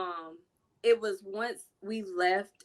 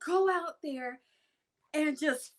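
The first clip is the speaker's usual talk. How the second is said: coach voice; 5 semitones higher than usual. Retold or imitated. Coach advice.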